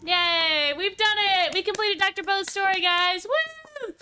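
excited high cheer; whooping victory cheer. Real, not acted. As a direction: celebrate the win, way up high. Celebrating finishing a game story.

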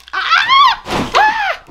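sharp high shriek of fright; startled scream. Real, not acted. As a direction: scream in sudden alarm. Things fell, dog scared.

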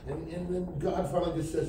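ordinary delivery

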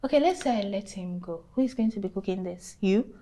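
normal talking voice

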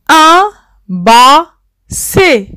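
un basset drawn out slowly, syllable by syllable; teacher slowly sounding out un basset for the class, slow and clear. Shown as a demonstration. Spell out Un basset slowly.

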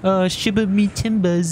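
mock British accent. Friend joining the British bit, slow mock British accent. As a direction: ham up a British accent, slowly.

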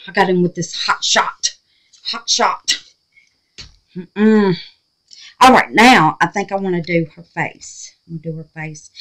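usual speaking voice

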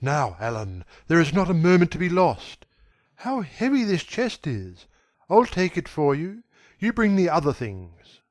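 brisk, commanding, fast; rapid and authoritative. A narrator's reading. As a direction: take charge and hurry him along. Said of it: "He spoke rapidly and in an authoritative manner".

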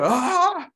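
startled mock shriek; imitating mum's fright. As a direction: mimic his mum jumping in fright with a sharp high cry. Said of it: "She gets so scared".

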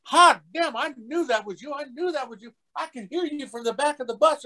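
very high-pitched, gushing mock excitement; imitated excited fan. Fan has spotted actor from back of bus, high excited crowing. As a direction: crow it in a squeaky fan voice. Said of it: "And he goes".

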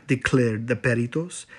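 ordinary delivery

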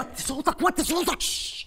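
tense, pressing command to keep it down; urgent hushing. Acted. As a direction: hush him before anyone hears. Father hushing shouting son.

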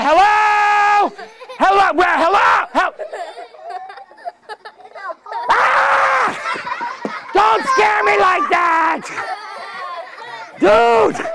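hammed-up startled yelps; mock fright. Magician pretends to be startled.

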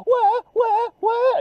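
high mock "wah" crying. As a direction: imitate a kid's whiny wail. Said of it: "And I'm go".

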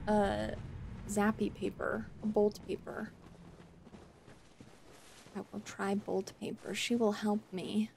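soft muttering to herself; muttering quietly. Real, not acted. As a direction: mutter under your breath. Muttering to self in fight.

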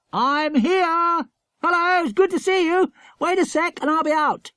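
high, loud hail to friends; calling out. A narrator's reading. Greeting the other children from his hide, calling loudly. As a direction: call out gladly across the courtyard. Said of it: "Jack gave a hail".

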